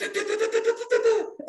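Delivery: high, stuttering mock sob; imitated sobbing. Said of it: "like, sobbing, like".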